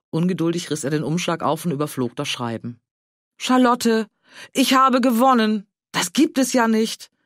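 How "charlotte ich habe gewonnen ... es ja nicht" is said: loud and higher, can't believe his luck; bursting with the news of the win; thrilled. Acted. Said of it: "blickte seine Frau strahlend an". (aufgeregt, lauter und höher)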